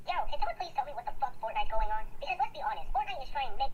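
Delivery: high, sped-up chipmunk voice; helium-pitched cartoon squeak. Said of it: "So this is like a chipmunk voice version".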